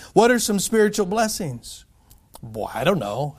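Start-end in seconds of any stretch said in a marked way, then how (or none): 2.4-3.4 mock ignorance. Mock ignorance before answering.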